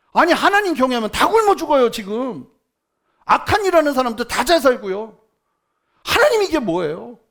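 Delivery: loud, exasperated protest at God; angry complaint to God. Retold or imitated. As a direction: shout your grievance up at heaven. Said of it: "막 화가 나서".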